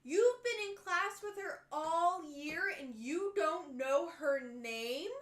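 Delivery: loud, slow incredulous teacher; mock outraged teacher, drawn out; teacher's indignant retort. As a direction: scold him like a shocked teacher. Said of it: "And the teacher goes".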